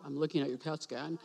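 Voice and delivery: low, soft, downcast delivery; mock gloomy announcement. Shown as a demonstration. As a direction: pretend it's bad news, voice sunk down.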